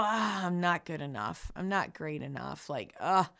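low, soft, deflated grumble of someone who feels not good enough; mock self-doubt. Listener on a tired morning, in a low, soft, defeated sigh. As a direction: sink the voice low and quiet, sighing out the doubts. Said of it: "Some mornings you might go".

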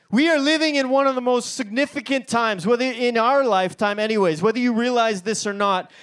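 usual speaking voice

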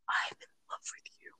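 barely audible, voiceless confession; lovestruck whisper. Retold or imitated. Teenage fan confessing to a favourite actor, acted lovestruck whisper. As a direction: whisper it like a smitten teen, very softly.

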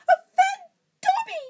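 tearful, very high loud elf cry; choked. A narrator's reading. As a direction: choke it out in a wailing falsetto. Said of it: "choked the elf".

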